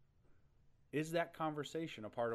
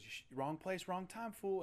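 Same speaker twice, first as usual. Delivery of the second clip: lightly voiced gangster threat, pitched up; a hint of menacing gangster; retold gangster; 7 semitones higher than usual. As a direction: play a street tough in a retelling. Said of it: "gangster dress guys come up".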